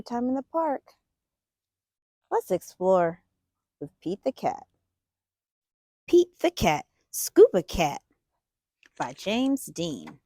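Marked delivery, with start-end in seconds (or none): none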